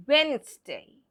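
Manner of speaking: Wednesday stressed and said WENZ-day; correct silent-D pronunciation. Shown as a demonstration. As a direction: leave the first D out of Wednesday. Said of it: "A next sentence".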